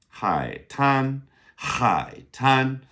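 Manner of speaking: "Hai tan" with a breathy aspirated H, tan held high. Shown as a demonstration. Teacher showing how to say beach, aspirated H. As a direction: say "Hai tan" slowly as a model, strong airy H on Hai. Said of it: "That's our aspirated H".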